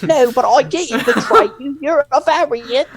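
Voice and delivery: high put-on pleading voice; mock Loki protesting. Friend imitates Loki pleading with Mobius, high put-on voice. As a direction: insist he's innocent, pitched high and insistent. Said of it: "And Loki's like".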